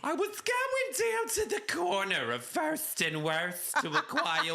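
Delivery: high-pitched caricature of a chatty caller; Fran. Acted. Caller telling how she found the podcasters, Fran voice. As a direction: spin a gossipy tale in a put-on high voice. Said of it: "we introduced Fran".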